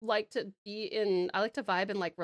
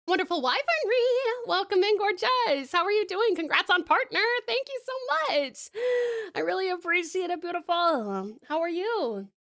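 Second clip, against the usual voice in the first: excited greeting; 9 semitones higher, 5 dB louder than usual. Real, not acted. Greeting new chatter.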